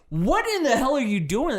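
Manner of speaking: acted-out baffled outburst, pitched high; mock exasperation. Viewer reacting to the accent slipping back in, mock exasperated.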